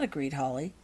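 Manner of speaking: usual speaking voice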